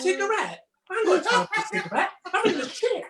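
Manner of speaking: high, fast, laughing mock panic; mock panicked chef. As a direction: squeal it out through laughter, panicked. Mimicking Daniel's panicked outburst.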